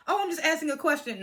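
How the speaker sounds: mock innocence. Mimicking people covering up their shade, mock innocence. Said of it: "People try to cover it up".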